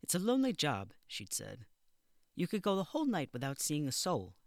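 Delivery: warning of lonely nights; stern matron. Acted. Matron on phone interview.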